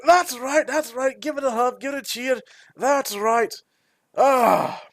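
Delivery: high, gleeful dwarf cheering; hearty dwarf. Acted. Dwarf squeezing the werebear in a hug, gleefully. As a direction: egg them on through a big bear hug.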